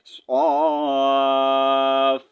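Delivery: صاف with the long aa stretched very slowly, then a light fa at the end. Shown as a demonstration. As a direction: Quran teacher stretching the madd in صاف. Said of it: "فا کا ہلکا سا ہم نے جو ہے یہاں پر ساؤنڈ نکالنا ہے".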